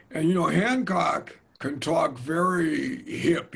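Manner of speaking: ordinary talk, nothing marked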